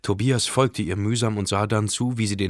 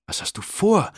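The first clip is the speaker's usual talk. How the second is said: high, louder alarmed cry; horrified question; 9 semitones higher, 4 dB louder than usual. A narrator's reading. Katrin seeing Tobias turn back, horrified. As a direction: blurt it out in shock. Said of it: "fragte Katrin entsetzt".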